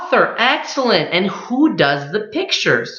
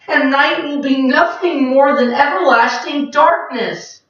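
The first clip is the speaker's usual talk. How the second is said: pouting night creatures; 7 semitones higher than usual. Acted. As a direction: whine about the lost moon.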